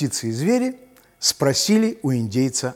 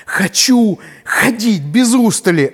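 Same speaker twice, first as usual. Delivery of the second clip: deer voice; 7 semitones higher, 6 dB louder than usual. Acted. Deer asks for stamina.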